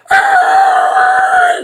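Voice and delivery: long, piercing high squeal; imitated tire screech. Man telling how the rival driver took off, imitated tire screech. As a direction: screech like spinning tires. Imitating the racing car peeling away.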